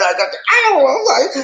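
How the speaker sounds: flustered. Acted. Man tongue-tied meeting a woman, flustered. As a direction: play tongue-tied, pitch climbing.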